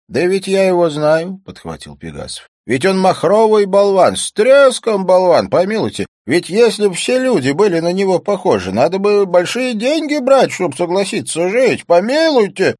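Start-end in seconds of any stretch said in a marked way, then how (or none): 0.1-1.4 loud, high character voice; high-pitched blustering interjection; cantankerous cynic, cutting in. A narrator's reading. 2.6-12.7 loud, high scornful character voice; blustering, high-pitched contempt; cantankerous cynic, ranting. A narrator's reading.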